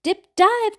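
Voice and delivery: high, loud call; cried out. A narrator's reading. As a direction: bat mother urging her young. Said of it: "cried the mom".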